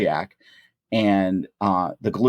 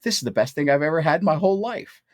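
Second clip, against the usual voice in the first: higher, faster mock rapture; retold delight; 7 semitones higher, faster than usual. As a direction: gush over the first bite, retold. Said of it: "And I was like".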